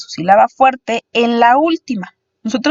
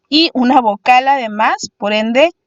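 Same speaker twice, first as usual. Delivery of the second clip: ordinary talk, nothing marked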